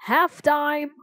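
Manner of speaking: high referee's yell; yelled call. A narrator's reading. Referee on the sideline to running kids, yelled. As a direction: yell it out to a whole field. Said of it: "blew a whistle and yelled".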